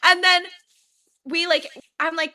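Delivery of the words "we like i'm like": ordinary talk, nothing marked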